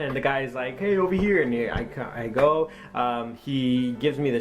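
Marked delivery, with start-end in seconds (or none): 0.8-1.4 raised, higher call to get attention. Retold or imitated. Fire truck guy calls the new helper over, calling out.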